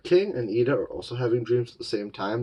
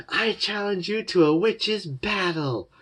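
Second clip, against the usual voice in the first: high, slow mock-formal challenge; haughty challenge; 11 semitones higher, slower than usual. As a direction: throw down the gauntlet like a rival witch. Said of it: "Amity's all like".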